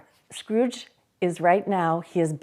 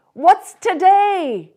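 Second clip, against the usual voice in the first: loud, high, slow call; 12 semitones higher, 11 dB louder, slower than usual. Acted. Scrooge calling down to a boy below, loud. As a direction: call down to the street. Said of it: "cried Scrooge, calling down to a boy".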